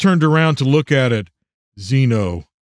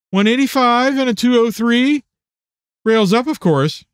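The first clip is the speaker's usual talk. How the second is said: lightly voiced woman, pitched up; a higher, teasing lilt; friend's playful report; 9 semitones higher than usual. A narrator's reading. Jennifer telling Chris the bowling score, lightly playful.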